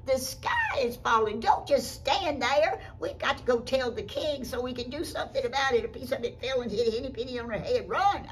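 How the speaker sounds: loud, high, urgent storybook cry; hammed-up panic, rallying them to act; panicked alarm. Animals warn the duck, urgently crying out.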